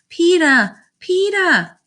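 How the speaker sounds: put-on high little-kid call, falling off; toddler calling out. A narrator's reading. Toddler Fudge calls his brother from the jungle gym, high calling. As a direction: call for big brother in a tiny child's voice. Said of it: "how he always says my name".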